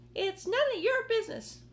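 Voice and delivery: very high, loud snap; snapping aunt. Acted. Aunt Spiker snapping at James for murmuring, high loud snap. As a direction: snap at the boy to shut up. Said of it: "Aunt Spiker snapped".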